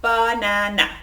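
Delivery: "banana" said slowly, one beat per syllable; tapped syllables. Shown as a demonstration. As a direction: tap out ba-na-na. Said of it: "So for example".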